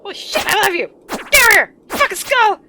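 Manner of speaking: high, loud startled shouting at a game enemy; excited yelling. Real, not acted. Gamer swearing at a skull monster, excited yelling. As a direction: yell it out in a sudden high-pitched burst.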